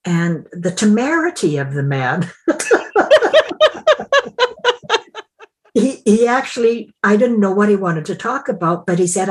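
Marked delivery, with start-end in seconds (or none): 2.3-5.2 high, bursting laugh of disbelief; real laugh. Real, not acted. Laughing at the webmaster's temerity, a real high burst of laughter.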